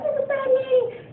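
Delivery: put-on high, excited little kid voice; imitated small child calling out; scared kid. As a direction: peek out and squeal like a child. Kids peeking out scared.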